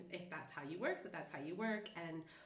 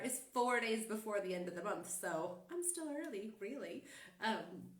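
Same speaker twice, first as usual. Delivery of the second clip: ordinary delivery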